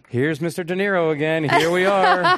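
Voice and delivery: high, loud, hammed-up come-on; mock seduction. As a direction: tease him like a lover on stage. Mock flirty roast of De Niro.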